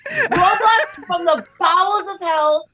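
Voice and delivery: slow, high, laughing mock-dramatic; drawn out through laughter. Real, not acted. Mother rants about daughter's game, laughing.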